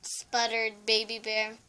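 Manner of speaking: plain reading voice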